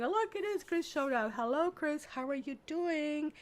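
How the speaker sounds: high, sing-out hello to a newcomer; real excited welcome, pitched well up; delighted greeting. Real, not acted. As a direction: break off, pleasantly surprised, and greet them brightly. Greets Chris who suddenly shows up.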